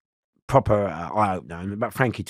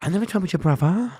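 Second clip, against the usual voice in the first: high, small, mock-scared denial; feigned timid denial; 8 semitones higher than usual. Playing dumb to the gang member, high timid denial. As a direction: pretend to be shook. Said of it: "So I said".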